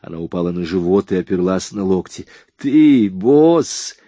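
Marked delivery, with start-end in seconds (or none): 2.6-4.0 higher, louder mocking echo; ironic. A narrator's reading. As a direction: sneer his word back at him. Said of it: "переспросила ... иронически кривя губы".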